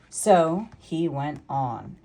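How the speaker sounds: usual speaking voice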